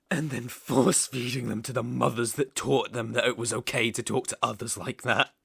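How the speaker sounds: loud, venomous fury; rising rage. Acted. Villain recalling insults at the summit, loud furious outburst. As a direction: spit out the hatred.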